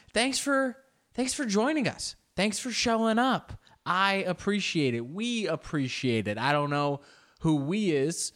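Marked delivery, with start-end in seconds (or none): 0.1-6.4 1 mock thanks. High mock-grateful greeting.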